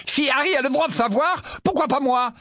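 high, indignant outcry; exclaimed. A narrator's reading. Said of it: "s'exclama Ron".

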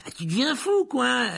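high, loud startled outburst; crying out. A narrator's reading. Conductor scolding a passenger for the forbidden name, crying out. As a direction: exclaim in alarmed disbelief. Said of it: "s'écria Stan".

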